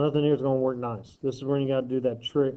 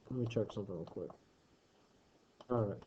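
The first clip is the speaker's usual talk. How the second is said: low, much softer aside; muttering; 4 semitones lower, 10 dB quieter, much faster than usual. Real, not acted. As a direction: mutter it to yourself. Aside while checking work.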